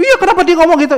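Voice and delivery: high-pitched mock retort; angry child. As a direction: snap back like an indignant kid. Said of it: "satu anak yang marah-marah". (tiruan anak membantah dengan kesal)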